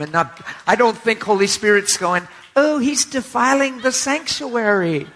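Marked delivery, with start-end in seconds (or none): 2.6-5.1 high, slow mock outrage; mock scandalized. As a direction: put on a horrified, high-pitched pious voice. Mocking a scandalized religious objection.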